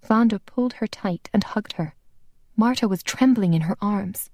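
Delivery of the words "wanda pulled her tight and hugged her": ordinary delivery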